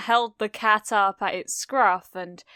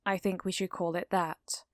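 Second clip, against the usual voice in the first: quiet; 7 dB quieter than usual. Acted. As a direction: barely voice it. Todoroki quiet.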